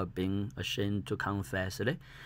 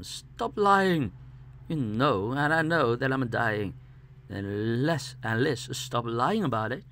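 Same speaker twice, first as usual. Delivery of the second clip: raised, higher-pitched protest; calling out; 3 semitones higher, 5 dB louder than usual. Acted. Sick man to those lying about his dying, calling out in protest. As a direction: call out loudly, demanding an end to it. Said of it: "calling up to them".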